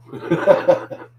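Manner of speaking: loud, high burst of laughter; sudden amused laugh. Real, not acted. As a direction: laugh out loud at your own slip. Laughs at own foreign-language attempt.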